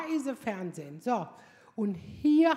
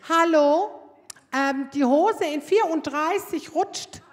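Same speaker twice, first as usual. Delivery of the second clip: loud hallo, then slow and loud complaint; woman calling to the saleswoman through the shop, loud and slow; yelled across; 3 semitones higher, 7 dB louder, slower than usual. Retold or imitated. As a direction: call out from the changing cubicle. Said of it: "brüllt".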